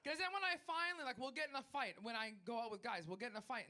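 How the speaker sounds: usual speaking voice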